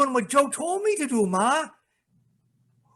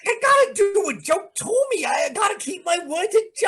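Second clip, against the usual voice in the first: frantic shout; 12 semitones higher, 4 dB louder than usual. Acted. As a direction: yell it out, half crazed. Son struggles, shouting at mother.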